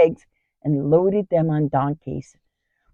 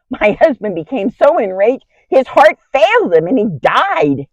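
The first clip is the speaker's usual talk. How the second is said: higher, building louder toward the climax; dramatic climax; 6 semitones higher, 5 dB louder than usual. Acted. Wife tells of husband's fatal rage, dramatic build. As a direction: drive the story up to its shock.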